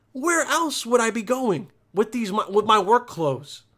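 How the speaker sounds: full-voiced incredulous comeback; exasperated retort. Acted retort to mom, repeated aloud.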